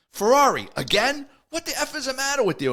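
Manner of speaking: high, loud imitation of a fed-up sergeant; exasperated sergeant's scolding. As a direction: chew out the rookie, hammed up and high. Sergeant berates rookie holding up line.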